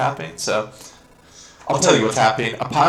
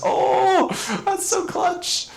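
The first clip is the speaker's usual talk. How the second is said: very high, slow thrilled squeal; excited squeal; much slower than usual. Real, not acted. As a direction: squeal with relief at the close call. Narrow escape in boss fight.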